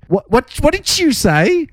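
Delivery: put-on high, slow, chewed Tasmanian accent. Imitating a broad Tasmanian asking what you said, high and slow. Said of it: "a chew in their thing".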